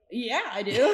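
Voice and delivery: eager, bursting acceptance; excited yes. Retold or imitated. Laughing as she accepts the coach's offer on the phone.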